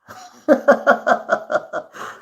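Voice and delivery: long airy high-pitched laugh; high breathy laugh. Real, not acted. Laughing at a viewer's kind comment, a high airy giggle.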